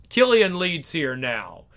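usual speaking voice

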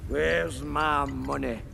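broad Scottish brogue, crude and cheerful; thick Scottish accent, pleased with himself; Fat Bastard's Scottish. Acted. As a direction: play it as a coarse Scottish lout. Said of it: "Fat bastard".

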